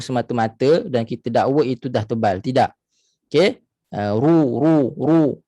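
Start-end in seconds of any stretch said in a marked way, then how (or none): 4.2-5.4 RU heavy, lips rounded, tongue back up; thick ru. Shown as a demonstration. Teacher shows how it should be, thick ru. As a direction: repeat a dark, thick RU. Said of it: "Itu yang sepatutnya".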